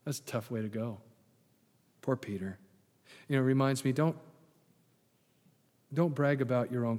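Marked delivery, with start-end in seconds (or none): none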